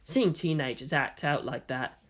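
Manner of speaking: plain reading voice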